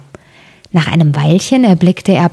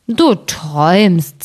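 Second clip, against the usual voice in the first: slow, heavy giant drawl; sleepy giant; much slower than usual. Acted. Second giant waves off his companion, slow and drowsy. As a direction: brush him off like a half-asleep giant.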